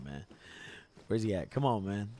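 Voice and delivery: usual speaking voice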